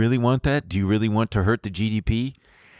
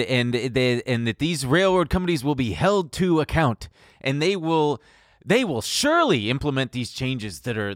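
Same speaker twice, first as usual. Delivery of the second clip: sarcastic. Acted. Sarcastic mock optimism about justice.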